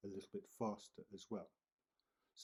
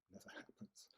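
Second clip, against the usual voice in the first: whispered laugh; 13 dB quieter than usual. Real, not acted. Amused aside whispered.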